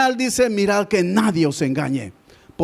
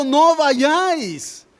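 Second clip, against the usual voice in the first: slow, higher, "vayáis" drawn out and stressed; emphatic warning; 6 semitones higher, much slower than usual. Real, not acted. Preacher warning the church, slow emphatic command. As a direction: plant each word like a command.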